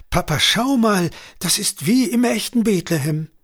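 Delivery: put-on high, bright-eyed child voice; excited little girl. A narrator's reading. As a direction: call out in wonder like a small child. Little girl calls excitedly to her father.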